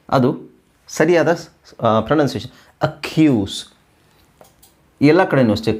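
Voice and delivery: normal talking voice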